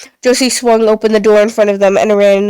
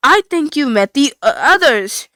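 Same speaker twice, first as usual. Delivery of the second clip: somber robot; 4 semitones higher than usual. Acted. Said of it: "Kanan's happy tone disappeared".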